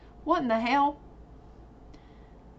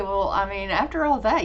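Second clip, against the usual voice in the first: ordinary talk, nothing marked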